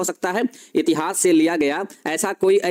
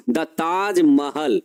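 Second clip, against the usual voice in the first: slow emphasis; 3 semitones lower, much slower than usual. Shown as a demonstration. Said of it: "क्या कहा हमने?".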